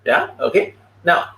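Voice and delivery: usual speaking voice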